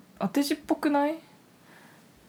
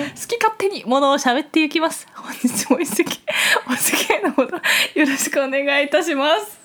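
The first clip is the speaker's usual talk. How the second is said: put-on raised, loud, elegant young-lady voice; haughty rich girl japanese; 5 semitones higher, 5 dB louder than usual. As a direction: greet guests like a refined heiress. Ojousama podcast greeting.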